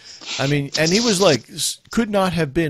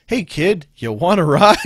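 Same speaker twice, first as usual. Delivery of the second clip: raised, drawn-out mock offer breaking into laughter; playful louder invitation with a laugh; calling out; 4 semitones higher, 6 dB louder than usual. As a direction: call a kid over for a ride. Acts out offer to a bystander.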